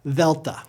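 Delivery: "Delta" as "THEL-ta", th as in this; soft Greek D. Shown as a demonstration. Teacher models the Greek letter, soft th delta. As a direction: start "Delta" on a voiced th. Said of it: "A soft D will sound like T H".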